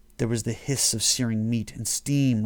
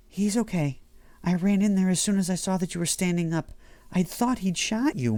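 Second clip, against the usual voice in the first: higher, anxious wife's voice; upset Mary; 9 semitones higher than usual. A narrator's reading. Mary tells Damien she feared he'd been shot, higher and upset. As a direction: speak fast and shaken as the relieved wife. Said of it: "Mary cried".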